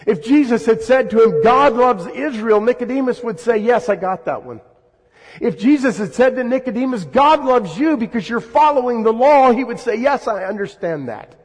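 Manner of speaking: high, loud, driving sermon climax; preaching at full force. Real, not acted. Preacher pressing the congregation about Nicodemus, high and loud. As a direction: build it up like a preacher on fire.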